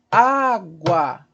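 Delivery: "Água" in two long, separated beats; syllable by syllable. Shown as a demonstration. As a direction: break "Água" into á and gua. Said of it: "Quantas sílabas?".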